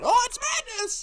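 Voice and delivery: high, loud mock outrage; hammed-up high-pitched pearl-clutching cry; outraged parent. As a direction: shriek it like a scandalized mom. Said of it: "today's society would just fucking lose their shit".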